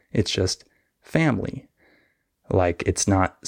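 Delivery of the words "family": family squeezed to two syllables, fam-lee; middle syllable skipped. Shown as a demonstration. Teacher summing up the fix, family with no middle syllable. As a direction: say family without its middle vowel. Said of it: "don't say the middle syllable".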